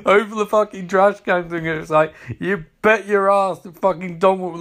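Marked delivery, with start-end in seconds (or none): none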